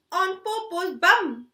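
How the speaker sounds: ordinary delivery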